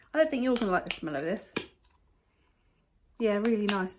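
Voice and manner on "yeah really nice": ordinary talk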